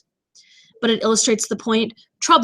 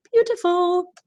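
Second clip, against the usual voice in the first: airy, lilting beautiful; 8 semitones higher than usual. Shown as a demonstration. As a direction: say it high and sweet, like a little chime. Playfully demonstrates how euphony sounds.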